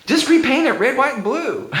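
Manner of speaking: imitating a friend's high, urgent plea; gut-reaction blurt. Friend pleading to keep the ceiling's colours, blurting. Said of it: "gut reaction".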